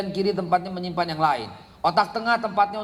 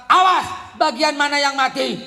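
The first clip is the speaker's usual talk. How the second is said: high loud scolding bark; snapping at a child; 6 semitones higher, 5 dB louder than usual. Retold or imitated. Preacher imitating a parent snapping at a child, loud. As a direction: bark at the child, then ask the question. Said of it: "kau bentak".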